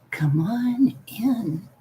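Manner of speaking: slow, drawn-out spooky whisper; whispered spooky invitation; creepy whisper. Pretend. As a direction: beckon them in, hushed and eerie. Spooky greeting to someone joining call.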